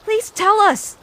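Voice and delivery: desperate plea for answers; pleading. Acted. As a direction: beg them to explain. Begging riverperson for answers.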